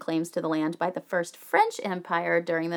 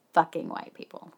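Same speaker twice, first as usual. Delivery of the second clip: grim aside; 3 semitones lower, 7 dB quieter than usual. Real, not acted. Grim aside after colonization history.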